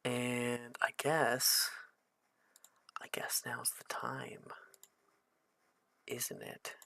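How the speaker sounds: hushed ominous. Acted. GM sets eerie mood.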